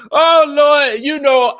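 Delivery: loud, high, worn out from laughing; coming out of laughter. Real, not acted. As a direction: exclaim as a big laugh subsides. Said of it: "I ain't never laughed so hard".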